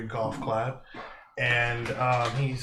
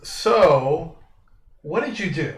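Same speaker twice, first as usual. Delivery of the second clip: loud, higher, confrontational game character; aggressive; 5 semitones higher, 7 dB louder, slower than usual. Acted. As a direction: challenge them, hitting the opening word hard. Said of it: "his voice is kinda, like, very aggressive".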